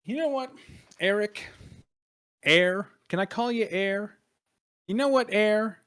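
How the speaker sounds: lightly acted taunting familiarity; needling familiarity. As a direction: tease him with the nickname in short bursts. Needling Eric with a nickname.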